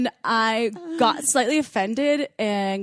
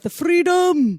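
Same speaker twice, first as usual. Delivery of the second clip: mock Scottish cry; 9 semitones higher, 6 dB louder, much slower than usual. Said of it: "in Scotland".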